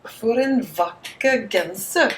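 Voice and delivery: For en vakker genser said slowly, for en VAK-ker GEN-ser; slow Norwegian For en vakker genser; Norwegian pronunciation. Shown as a demonstration. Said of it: "get Siri to say it for us first".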